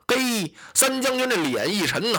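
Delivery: plain reading voice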